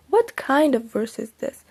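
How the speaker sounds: ordinary delivery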